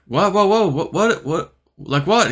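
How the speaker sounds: high startled whoa, slowing into confusion; alarmed surprise. Retold or imitated. Dreamer hears the crash news, high startled whoa. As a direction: blurt out your shock.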